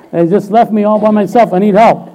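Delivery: high, loud mock plea for help; distressed call. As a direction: play a stranded caller wailing to a friend. Acts out a distressed phone call.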